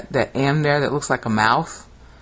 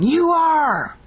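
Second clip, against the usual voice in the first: high, sustained exclamation; dramatic proclamation; 14 semitones higher, 4 dB louder than usual. Shown as a demonstration. Teacher voicing what the Greek really says, dramatic. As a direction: proclaim it high, holding both words. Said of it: "really they're saying".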